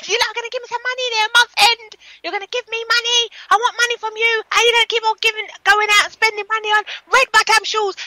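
high-pitched Jamaican-accented mock rant; Jamaican mother impression. Mimicking a Jamaican mother's money rant, Jamaican accent. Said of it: "she was just".

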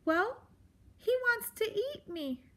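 high, small timid voice; faint frightened mouse. A narrator's reading. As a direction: squeak it out like a scared little mouse. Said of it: "Poppy said faintly".

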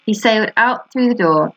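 plain reading voice

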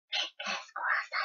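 soft unvoiced hope; whisper. Real, not acted. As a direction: breathe it as a secret wish. Wishes herself luck.

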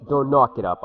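loud plea. Real, not acted. Pleading with the game opponent.

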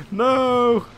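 high, loud panicked "No!"; panicked shout. Real, not acted. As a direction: yelp in alarm. Swarmed by enemies in game.

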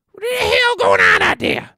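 high, booming title shout; announcer shout. Acted. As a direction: belt out the show title like a promo.

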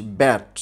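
ব্যাট said in a single beat; one syllable. Shown as a demonstration. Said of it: "একবারে উচ্চারণ করছি". (ব্যাট একবারে উচ্চারণ)

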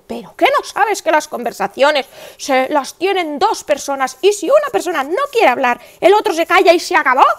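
sharp, rising mock scolding of her son; mother cuts off her son, stern but not shouting; scolding, no yelling. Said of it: "Y digo".

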